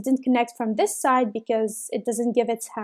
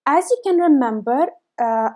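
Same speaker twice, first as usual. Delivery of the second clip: ordinary talk, nothing marked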